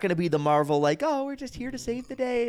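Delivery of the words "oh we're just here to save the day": high-pitched, sugary superhero line; lightly spoken, high heroic put-on; mock goody-goody hero. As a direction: parody a wholesome superhero. Mocking Marvel-style do-gooder heroes.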